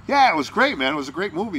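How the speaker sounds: high, loud replayed enthusiasm; retold eager praise. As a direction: gush as if replaying praise to a star. Acting out own eager praise to Berry.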